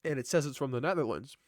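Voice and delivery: plain reading voice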